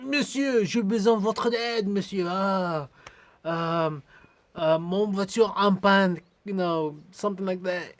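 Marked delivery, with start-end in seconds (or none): 0.0-6.2 high-pitched, careful beginner French; halting French. Retold or imitated. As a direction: stumble through schoolbook French to a stranger. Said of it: "the best ability of my French".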